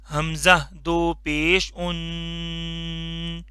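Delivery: very slow, drawn-out drill for repeating; slow drilled recitation. Shown as a demonstration. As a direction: stretch each syllable for the class to echo. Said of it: "मैं पढ़ता जाऊंगा, आपने मेरे पीछे repeat करते जाना है". (बहुत धीमे, खींचकर पढ़ाना)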